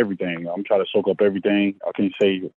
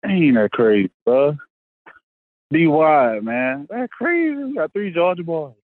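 excited; 8 semitones higher, 4 dB louder, slower than usual. Real, not acted. Overjoyed at teammate's pick.

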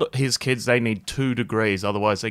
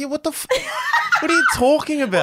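much higher, laughing, half-shouted disbelief; incredulous mock yell; faster than usual. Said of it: "I was like".

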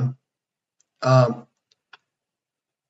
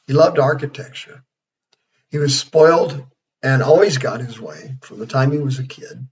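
ordinary talk, nothing marked

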